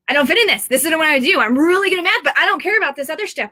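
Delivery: high, wailing imitation of overwhelmed kids; freaking-out kids. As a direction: voice a frustrated child's protest, pitched well up. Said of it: "these kids are freaking out".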